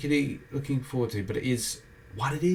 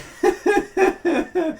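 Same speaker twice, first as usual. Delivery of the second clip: high laugh; 3 dB louder than usual. Real, not acted. Laughs at own joke.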